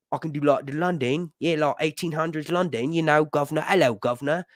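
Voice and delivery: mock eighteen-hundreds London accent; old London accent. Shown as a demonstration. Demonstrating an English accent to chat, old London accent. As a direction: put on an old-time London cockney accent. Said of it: "I can do the English accent".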